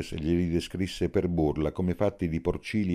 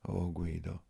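softly breathed-out address to a lover; hushed tenderness; 7 dB quieter, slower than usual. A narrator's reading. Tearful Ginia answers her lover. (sommesso e intimo)

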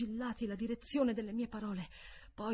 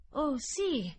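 put-on higher, louder childlike reply; boy's answer; 6 semitones higher, 5 dB louder than usual. A narrator's reading. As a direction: answer up high as the boy. Boy answers the governess.

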